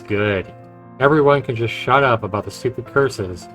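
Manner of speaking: ordinary talk, nothing marked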